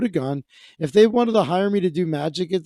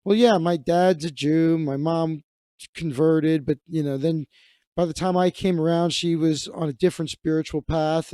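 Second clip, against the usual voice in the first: ordinary delivery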